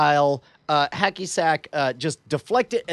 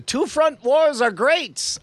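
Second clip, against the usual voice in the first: high, drawn-out proclamation; slow, high-pitched nonsense outburst; blurted to nobody; 10 semitones higher, slower than usual. Acted. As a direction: declare it grandly to the empty room. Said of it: "I say to no one in particular".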